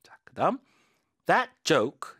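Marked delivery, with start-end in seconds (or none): 1.3-2.1 That said higher and louder; stress on That. Shown as a demonstration. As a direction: punch the word That. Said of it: "지시 형용사 힘 주시고".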